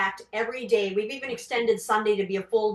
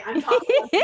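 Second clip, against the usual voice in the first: higher, louder amused laugh; laughing at herself; 7 semitones higher, 7 dB louder than usual. Real, not acted. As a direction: laugh at her own words. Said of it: "I don't talk like this".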